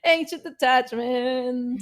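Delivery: slow, drawn-out voice of anxious attachment; ridiculous personification. Pretend. Anxious attachment talking to her, mock slow voice. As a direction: stretch it out as a silly character. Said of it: "This is totally ridiculous".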